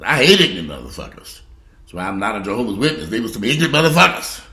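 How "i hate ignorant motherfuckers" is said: louder, stress on hate; the same line now shouted; loud outburst. Real, not acted. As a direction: punch hate hard. Repeats line, louder second time.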